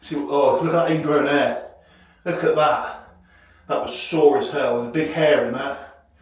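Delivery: normal talking voice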